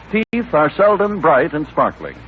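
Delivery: ordinary talk, nothing marked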